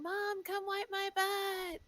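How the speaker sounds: put-on high, slow whiny little-kid call; little girl calling mom, pitched high and drawn out; child yelling from the bathroom. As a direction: mom imitating her small daughter calling for help. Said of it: "And she's yelling".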